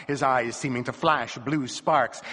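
ordinary delivery, nothing marked

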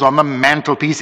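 plain reading voice

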